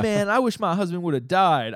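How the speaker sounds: hammed-up high, loud New York accent. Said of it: "I'm doing a horrible New York accent".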